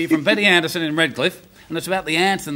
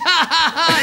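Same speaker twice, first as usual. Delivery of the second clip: high mock "ha, ha, ha"; gorilla-language laugh; 10 semitones higher, 4 dB louder than usual. Gorilla mocking the chasing lion, high hammed-up laugh. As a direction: imitate a gorilla laughing as it runs. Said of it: "in gorilla language".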